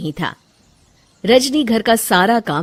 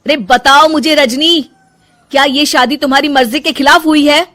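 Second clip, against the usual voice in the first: much higher, louder pleading; exasperated demand; 7 semitones higher, 6 dB louder than usual. Acted. Husband demanding to know if wife was forced to marry, loud. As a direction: shout for the truth.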